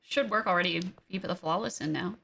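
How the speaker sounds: ordinary talk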